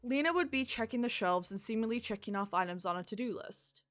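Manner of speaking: usual speaking voice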